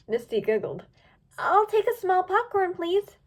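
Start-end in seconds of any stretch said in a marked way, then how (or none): 1.4-3.2 Misty's voice. A narrator's reading. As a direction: chirp the order up high. Misty disguised voice.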